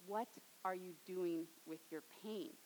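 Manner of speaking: hushed question. Real, not acted. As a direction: ask it gently to each listener. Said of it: "repeated sermon question".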